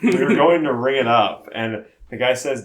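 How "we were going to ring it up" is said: normal talking voice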